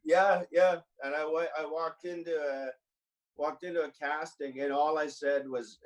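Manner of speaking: usual speaking voice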